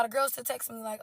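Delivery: ordinary delivery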